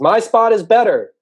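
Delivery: high, slow, very loud holler; far-off turtle yelling over; calling out loudly. Acted. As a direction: shout it across a long distance, stretching each word. Turtle calls from far away.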